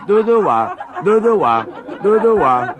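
high, loud siren imitation, laughing; laughing siren impression; mock siren. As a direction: wail dudúa like a fire siren. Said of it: "Y me decían".

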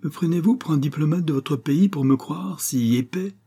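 a hint of the unflappable aristocrat setting terms; cool English lord. A narrator's reading. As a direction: lord bargaining with the major, coolly confident. Stamford's voice held.

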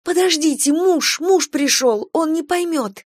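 high, agitated begging; panicked plea. Acted. Kira begging as her husband returns, panicked. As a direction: plead desperately in a high voice.

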